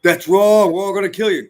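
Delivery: high, loud mock outrage; hammed-up angry hater, pitched high and loud. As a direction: shout it like a cartoon bigot. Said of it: "You're like".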